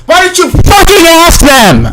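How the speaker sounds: real scream. Real, not acted. Streamer yelling at a game character in frustration. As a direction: let it rip at the character in frustration. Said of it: "I didn't mean to scream in the mic".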